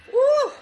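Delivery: high, loud woo; celebratory whoop. Real, not acted. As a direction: whoop like the team just scored. Celebrating the likes.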